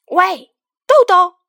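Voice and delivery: high, slow, drawn-out call across the shelves; child calling the beans by name, loud and slow. Acted. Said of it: "大声叫着".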